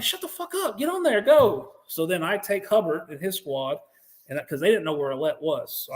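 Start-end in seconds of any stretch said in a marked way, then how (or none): 0.0-1.7 high, impatient retold command; exasperated order. Team leader to wounded Marine, imitated exasperated order. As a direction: order him along, pitched well up.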